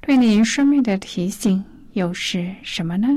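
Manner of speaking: normal talking voice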